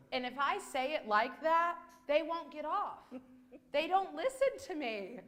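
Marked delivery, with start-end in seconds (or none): none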